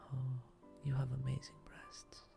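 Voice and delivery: usual speaking voice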